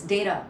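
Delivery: data as "day-da", T softened to a quick D; subtle D. Shown as a demonstration. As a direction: tap the T in data like a light D. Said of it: "subtle D".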